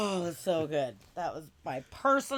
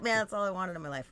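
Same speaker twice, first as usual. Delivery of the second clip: ordinary talk, nothing marked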